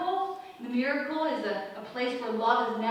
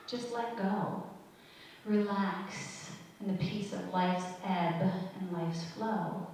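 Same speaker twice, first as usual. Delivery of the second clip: measured, softer poem read, lower voice; 6 semitones lower, 5 dB quieter than usual. Acted. Preacher reads a sandcastle poem to her listeners, gently.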